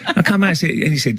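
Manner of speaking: ordinary delivery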